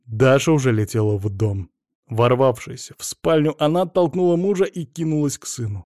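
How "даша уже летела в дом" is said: plain reading voice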